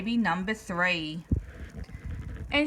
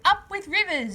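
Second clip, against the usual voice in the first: higher, cheerful hooting call; happy hoot; 7 semitones higher than usual. A narrator's reading. Duckbills hooting with joy at the river, higher cheer. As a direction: hoot it with delight. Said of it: "And they hooted".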